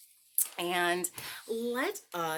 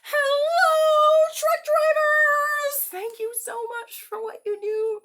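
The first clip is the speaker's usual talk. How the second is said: much higher and louder, long drawn hello; cheerful shout; 15 dB louder, slower than usual. Shown as a demonstration. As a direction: call out to the farmers. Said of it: "One, two, three".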